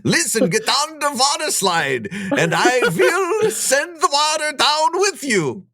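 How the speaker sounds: high, hammed-up Swedish slipping toward German; failed Swedish accent. Lifeguard giving slide orders in a failed Swedish accent. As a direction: push a bad Swedish accent high, slowing down. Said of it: "I can't find my Swedish for nothing".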